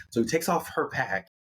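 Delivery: plain reading voice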